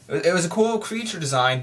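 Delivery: ordinary delivery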